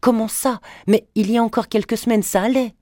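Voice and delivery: shocked daughter cries out to her mother; crying out in shock. A narrator's reading. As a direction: blurt it out in disbelief. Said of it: "s'écria".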